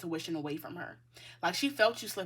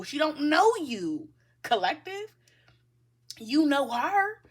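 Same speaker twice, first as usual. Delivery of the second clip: drawn out and pressed, higher and louder; slow emphatic; 6 semitones higher, 6 dB louder, slower than usual. Real, not acted. Reader stressing to viewer that mother doesn't know them. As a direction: lean on each word slowly.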